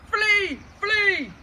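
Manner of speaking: loud, high cries of panic; panicked shouting. A narrator's reading. Big Bill sees the dragon and yells in panic. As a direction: yell the wrong animal in fright. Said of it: "and he shouted".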